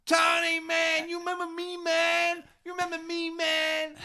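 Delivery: hammed-up New York fan yelling at Tony; crazy fan shout. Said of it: "he's going".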